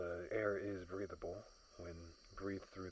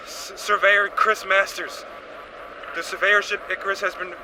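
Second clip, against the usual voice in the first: loud distress call over a crackling radio, higher; shouted radio mayday; 10 semitones higher, 16 dB louder than usual. Acted. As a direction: yell the mayday into the comms over the noise. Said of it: "as the radio transmission said".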